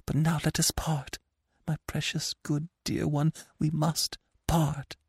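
tender desperate parting; sad hushed farewell. A narrator's reading. Anna pleads softly.

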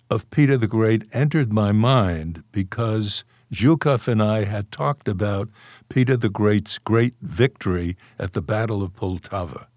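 usual speaking voice

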